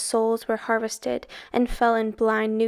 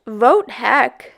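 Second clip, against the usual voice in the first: booming god; 7 dB louder than usual. A narrator's reading. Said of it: "Sam Hain boomed".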